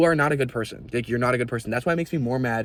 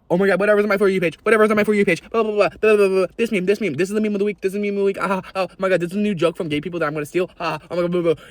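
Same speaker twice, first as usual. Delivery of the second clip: mocking airhead; 6 semitones higher, faster than usual. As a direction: gush like a trend-obsessed teen. Mocking TikTok-brained peers.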